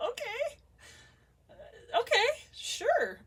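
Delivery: okay jumps way up high in surprise; startled okay. Retold or imitated. Telling viewers how she reacted to the request, high startled agreement. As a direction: caught off guard, she squeaks out her yes. Retelling her own startled reply.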